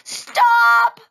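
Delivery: put-on high, loud child's yell. A narrator's reading. Taya picked up by the hair, yelling. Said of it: "Taya yelled very loudly".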